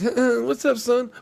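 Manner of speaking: high, hammed-up squeaky greeting; a mock caricature of Shorty; a host mocking a badly done Shorty voice, very high; Shorty impression. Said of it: "I'm Shorty. Watch this".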